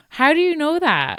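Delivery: high-pitched incredulous retold question; mock astonishment. Retelling reply to strangers spotting the accent, mock astonished. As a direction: jump up high in playful disbelief.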